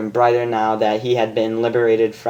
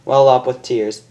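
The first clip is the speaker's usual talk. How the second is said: ordinary delivery, nothing marked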